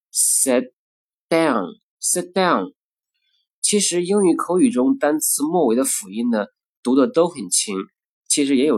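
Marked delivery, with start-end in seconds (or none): 0.1-2.7 sit down with the t held before d; sit down with unreleased t; lost plosive. Shown as a demonstration. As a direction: swallow the t in sit down. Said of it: "前面爆破音呢就要失去爆破".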